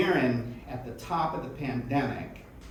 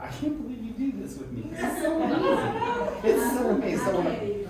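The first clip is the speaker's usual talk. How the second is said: high, laughing delight; laughing gush; 8 semitones higher than usual. Real, not acted. Host thanking her co-host, high laughing gush. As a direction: gush through laughter at the wonder of it.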